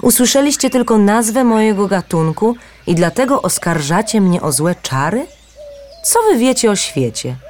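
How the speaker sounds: a paradise bird pleading to be understood; bird voice, indignant. A narrator's reading. Mekere voiced, higher. (wyższy głos ptaka, urażony)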